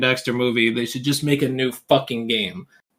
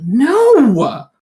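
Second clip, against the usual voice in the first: loud emphatic no; 11 semitones higher, 6 dB louder than usual. Real, not acted. Friend insists they're the same.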